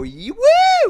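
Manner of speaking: very high, loud whooping cheer; mock audience cheer. Comedian imitating a crowd asked for dark jokes, mock whooping cheer. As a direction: whoop like an over-eager crowd. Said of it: "they always go".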